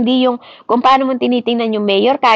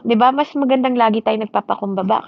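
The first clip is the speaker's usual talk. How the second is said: normal talking voice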